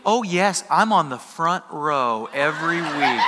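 slow, deliberate imitation of the gal; lightly voiced gal, stretching each phrase; pointed reply. Gal reveals she is a congregant.

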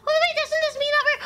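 high, excited cry of discovery; excited exclaim. Real, not acted. Player realizing the coyote is dreaming, high and thrilled. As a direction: blurt it out in delight.

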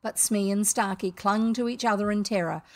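plain reading voice

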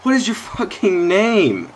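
slow, high, emphatic "What is your fucking name?" hammering "what"; real exasperated yell; exasperated demand. Real, not acted. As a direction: drag it out in disbelief. Demanding player's name, escalated take.

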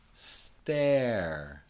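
STARE drawn out with long vowel; going slow. Shown as a demonstration. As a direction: stretch STARE slowly for learners. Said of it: "going a little slow".

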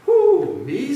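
very high, loud hoot, then softening; hooting owl. A narrator's reading. Owl astonished by the cactus's offer, hooting. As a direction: hoot the first word like an owl.

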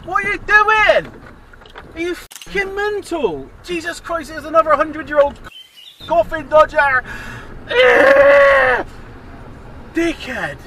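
high, loud, slow outraged yelling; furious shout. Real, not acted. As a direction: shout abuse out of the car. Yelling at driver who pulled out.

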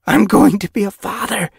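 high, loud, awed delight; breathed amazement. A narrator's reading. Ragged Pelt learning he will be a father, amazed. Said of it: "he breathed".

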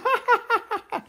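put-on a high, happy baby laugh; high baby giggle.

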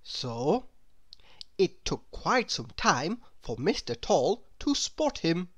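ordinary delivery

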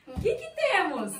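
high excited sing-song reveal. Real, not acted. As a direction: build up the surprise, laughing. Surprise reveal for the kids.

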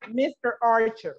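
normal talking voice

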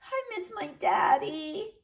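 put-on high soft tearful child; crying little girl. A narrator's reading. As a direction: whimper it through tears. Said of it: "said Minuet".

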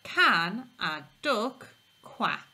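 slow, with can stressed; teacher reading aloud to children; proper question. Shown as a demonstration. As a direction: read it slowly as a real question. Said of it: "sounds like a proper question".

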